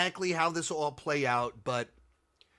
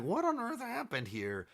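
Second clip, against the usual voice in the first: higher, softer mock Thor voice; 7 semitones higher, 6 dB quieter than usual. Thor returns to Earth, confused by new Avengers, in a higher, softer voice. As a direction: play Thor baffled on his return. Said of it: "the idea of Thor returning and being like".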